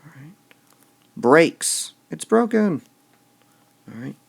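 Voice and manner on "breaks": "Breaks" loud and punched; stress on breaks. Shown as a demonstration. Teacher scanning the line aloud, stressing "Breaks" loudly. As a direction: hit "Breaks" hard. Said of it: "We want to emphasize all those".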